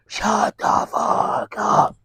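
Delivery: slow, hushed mock crowd chant in unison; crowd chorus. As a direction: intone it like a whole room in sweet unison. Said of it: "a choir of angels".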